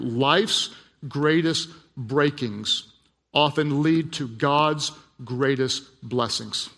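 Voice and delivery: slow, deliberate, word by word; preacher landing his key line slowly; emphatic declaration. Real, not acted. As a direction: weigh each word as a heartfelt conviction. Solemn declaration of his central conviction.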